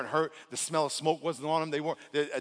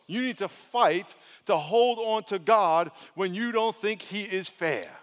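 normal talking voice

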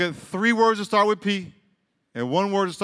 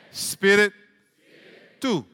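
ordinary talk, nothing marked

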